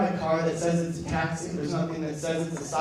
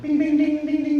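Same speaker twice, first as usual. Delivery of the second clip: high, loud dinging; imitated app alert; 10 semitones higher, 7 dB louder than usual. Driver imitating the ride alert, high insistent dings. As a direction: mimic a phone alert in high, ringing dings.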